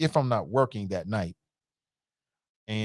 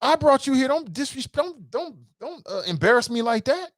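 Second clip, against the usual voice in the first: much higher, scolding his friend; mock alarm, pitched way up; high-pitched retold scolding; 13 semitones higher than usual. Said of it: "I'm like".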